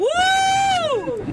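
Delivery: loud high whoop; cheering woo. Real, not acted. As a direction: let out a big cheer. Cheering after group chant.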